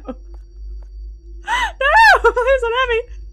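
very high panicked wail; frightened squeal. Real, not acted. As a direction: squeal out in terror. Frightened by the game.

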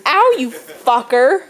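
loud, drawn-out yelp of complaint; mock pained yell. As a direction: cry out as if just hit, slowly. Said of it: "it should have been".

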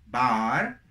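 "Bar" with long open ah; contrast drill. Shown as a demonstration. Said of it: "Ya tenemos cuatro". ("Bar" con A abierta)

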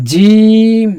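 জিম called out high and loud, drawn out; clear recitation. Shown as a demonstration. Teacher reciting জিম loudly for students to repeat. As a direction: project জিম like a Quran recitation. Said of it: "এটা ভালোভাবে বলবেন".

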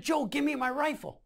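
high, insistent demand; urgent but not shouted; retold demand.

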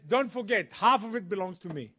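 loud, pointed reminder; retold insistent claim. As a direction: imitate her staking a claim forcefully. Helen staking her claim.

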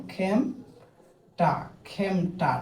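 খেমটা very slow, split into খেম and টা; demonstrated pronunciation. Shown as a demonstration. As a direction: sound out খেমটা syllable by syllable with an open "ae" vowel. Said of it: "উচ্চারণটা হচ্ছে এরকম".

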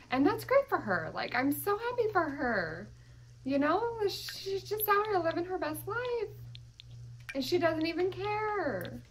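high, lilting mock happiness; hammed-up sarcastic gushing; sarcastic cheer. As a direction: gush with fake delight through your frustration. Sarcastically happy for stubborn Sim.

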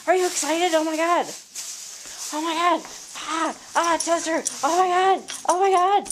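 high, loud, gushing pet voice; excited pet talk. Real, not acted. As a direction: coo at the dog in delight. Gushing over the pet Chester.

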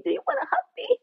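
very high, cutesy offer; over-sweet invitation. Acted. Offering scoffers a hug, over-sweet. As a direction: coax them in a tiny high voice.

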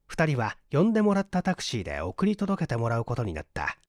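ordinary delivery

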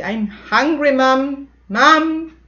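put-on high, loud, slow child whining to mom; a hungry child calling to mom for food. Shown as a demonstration. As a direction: whine for food like a hungry kid. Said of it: "you say".

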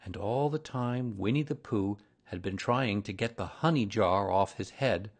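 ordinary delivery, nothing marked

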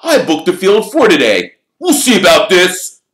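loud, high indignant protest; indignant captain ready for a confrontation; outraged. A narrator's reading. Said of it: "Wood hissed in outrage".

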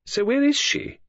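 high, insistent question; sharp demand at a high pitch. A narrator's reading. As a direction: demand an answer, pitched well up. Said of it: "demanded Claudia".

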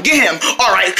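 ordinary talk

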